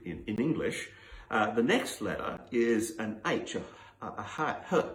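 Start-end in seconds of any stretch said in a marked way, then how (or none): 4.1-4.9 h, h breathed out as a bare aspirate; voiceless h, h demonstrated; h sound demo. Shown as a demonstration.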